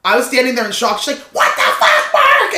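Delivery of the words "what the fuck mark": imitated furious high-pitched shriek; losing her mind. Girlfriend finds Mark in the guest's bed, shrieking. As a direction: mimic an outraged, screeching girlfriend. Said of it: "she's, like, losing her mind".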